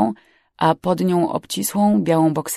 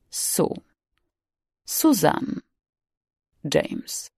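softer names with long pauses; cold echo; 5 dB quieter, much slower than usual. Acted. Captain repeating the frightened stowaway's names, coldly measured. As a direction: repeat each name slowly back, one by one.

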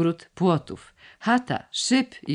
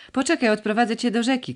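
high, quick call; raised pitch, hurried mild shout; calling after him; 9 semitones higher, faster than usual. A narrator's reading. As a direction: call out hurriedly to someone walking away. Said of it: "krzyknął Ragis za nim".

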